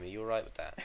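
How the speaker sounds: ordinary delivery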